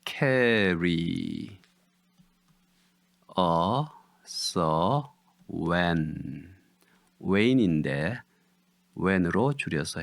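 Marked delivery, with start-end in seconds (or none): 0.1-6.6 "Car-ry, us, when", stretched with long gaps; syllable by syllable. Shown as a demonstration. Teacher gives syllables of "Carry us when", drawn out and separate. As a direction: spell out "Carry us when" one syllable at a time. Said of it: "음절은요".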